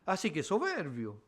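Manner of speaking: soft, sly, sneering devil voice; the devil gloating quietly over the confession; mocking devil. Devil mocking Luther for confessing his sins, in a sly, soft sneer. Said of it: "Y el diablo".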